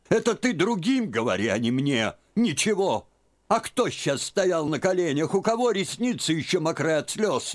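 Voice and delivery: put-on high, insistent, heated old woman; scolding old aunt. A narrator's reading. Aunt scolds Liza, disbelieving. (тётка отчитывает Лизу, высоким голосом)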